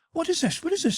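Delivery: very high-pitched panicked woman; frantic, high-pitched questioning; alarmed cry. A narrator's reading. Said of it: "she cried".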